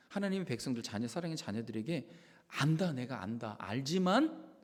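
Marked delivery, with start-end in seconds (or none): 2.5-4.5 soft, whispered fatherly plea; tender whisper of God. Retold or imitated. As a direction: gently whisper as God to his children. God's voice quoted tenderly. (하나님의 부드러운 속삭임)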